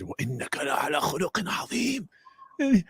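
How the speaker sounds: quick recited Arabic verse; Quran recitation. Retold or imitated. Student quotes the Quran verse to the sheikh, recited fast.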